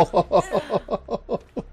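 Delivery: high, bursting laugh at herself. Real, not acted. Gamer laughing at her own jump scare, high laugh. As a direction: laugh off the fright.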